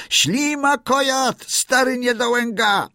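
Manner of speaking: high, drawn-out taunt from a crowd of birds; very high mocking yell; full-throated shrieking. A narrator's reading. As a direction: screech it like jeering seabirds. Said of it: "darło się na całe gardło, wykrzykując".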